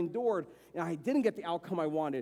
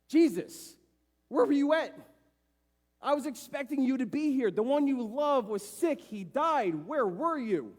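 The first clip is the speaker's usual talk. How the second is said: Martha lays into him; 5 semitones higher than usual. Retold or imitated. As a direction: give him a piece of your mind. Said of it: "Martha just lays into him".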